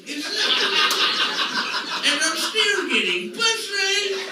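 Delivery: slow gloating boast. Retold or imitated.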